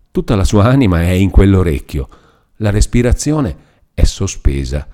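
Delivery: plain reading voice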